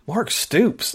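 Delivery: mildly incredulous retold reaction. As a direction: echo the name in mild disbelief. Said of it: "And I'm thinking".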